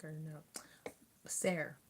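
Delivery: ordinary talk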